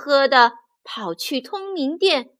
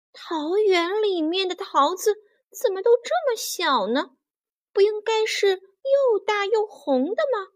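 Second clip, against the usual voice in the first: high-pitched puzzled fairy voice; surprised; 7 semitones higher than usual. A narrator's reading. Fairies finding only small green peaches, surprised. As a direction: wonder aloud in a higher voice. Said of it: "他们觉得很吃惊".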